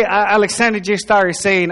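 normal talking voice

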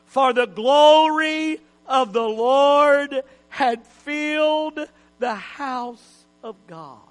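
slow, swelling then falling reading; dramatic scripture reading. Acted. Preacher reading the cloud verse, slow and swelling. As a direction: read it slowly, swelling on glory.

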